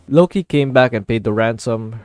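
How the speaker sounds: ordinary delivery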